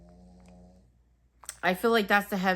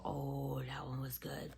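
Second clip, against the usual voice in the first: whispered; 5 semitones lower, 14 dB quieter, slower than usual. Real, not acted. Fond aside about a candle.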